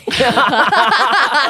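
a loud rising burst of laughter. Real, not acted. Guest cracks up at the host, laughing loudly. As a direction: let a big laugh rise up.

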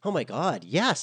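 high, loud relieved exclamation; excited. A narrator's reading. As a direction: gush it out with relief. Eager redhead finally found ride.

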